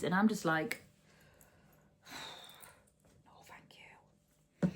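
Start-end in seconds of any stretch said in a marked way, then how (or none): 2.1-4.7 sighing whisper. Real, not acted. Refusing to knit more.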